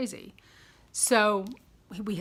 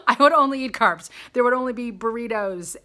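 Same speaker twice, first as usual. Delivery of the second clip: excited; 4 semitones higher, 9 dB louder than usual. Real, not acted. Gushing about loving carbs.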